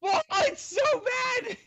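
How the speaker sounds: loud, very high wail through laughter; laughing wail. Real, not acted. Podcaster seeing his worst photo ever, laughing wail. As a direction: cry out in laughing mortification.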